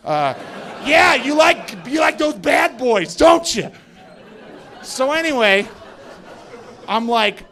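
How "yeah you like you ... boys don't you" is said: higher and louder, a teasing mock flirt; mock flirty taunt.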